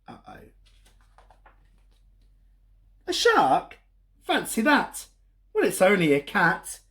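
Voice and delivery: lively, sing-song storybook reading; reading to children. A narrator's reading. Storyteller reading a picture book to kids. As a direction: read it like story time for little ones.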